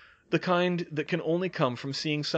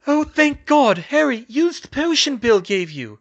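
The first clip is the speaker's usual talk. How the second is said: high, loud burst of relief; high-pitched urgent relief; relieved urgency; 12 semitones higher, 10 dB louder than usual. A narrator's reading. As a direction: cry out relieved, then urge him on. Relieved, urging Harry to use potion.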